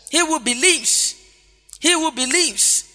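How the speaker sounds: slow, high, drawn-out "believes"; stress on believes. Shown as a demonstration. Preacher repeating scripture to the congregation, slow stress on "believes". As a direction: hammer "believes" home, stretching it each time. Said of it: "believing is the emphasis".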